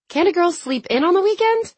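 high-pitched, climbing complaint; whining protest. A narrator's reading. Girl objects to an early start, whining protest. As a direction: push the question up into a whine.

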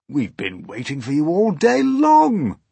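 high, loud grasshopper welcome; hearty old grasshopper. Acted. Old grasshopper welcoming James to the crew, hearty voice. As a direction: call it out, rising and loud.

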